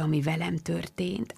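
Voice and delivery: ordinary delivery, nothing marked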